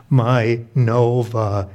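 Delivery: slow, low mournful mom voice; crushed. Acted. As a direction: mourn the lost car, drawn out and low. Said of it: "My mom is clearly crushed".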